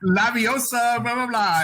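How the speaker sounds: high, loud hammed-up spell casting; a lightly hammed schoolboy wizard incantation; mock wizard spell. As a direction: wave a wand and cast it with a high mock flourish. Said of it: "pull out his wand and be like".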